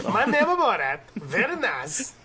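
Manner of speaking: put-on quick, laughing Kazakh-accent Borat voice; Borat impression. Co-host jokes about doing Borat, fast laughing Borat accent. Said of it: "I thought we were doing Borat".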